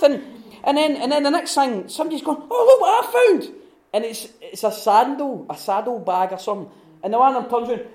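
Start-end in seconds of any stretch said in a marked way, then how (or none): 2.5-3.5 very high, loud, laughing delight; excited find. Retold or imitated. Hobbit holding up a saddle bag, high and thrilled. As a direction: squeal it out like a hobbit finding treasure.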